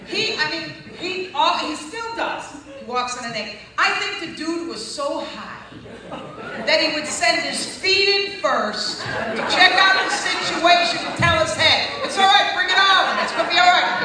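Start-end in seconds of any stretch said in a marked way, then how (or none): 3.8-11.9 loud, high and slow, laughing through it; hooting with laughter; laughing shout. Real, not acted. Laughing comic story. 12.0-14.0 loud, high comic voice; shouting comic voice; feet talking to the head. Pretend. Voicing the feet.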